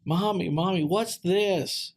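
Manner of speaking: put-on a kid in a toy aisle calling to mom, high and eager; kid yelling. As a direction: call out like an excited small kid. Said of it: "this kid yells out".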